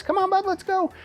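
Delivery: high, bright-pitched encouragement to the dog; motivating voice. Shown as a demonstration. Trainer urging his dog along in a high, motivating voice. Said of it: "talk in a motivating voice".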